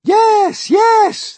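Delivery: very high, loud, excited cries; piercingly high cheer; chorused shout. A narrator's reading. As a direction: shout yes as an eager crowd. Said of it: "they chorused".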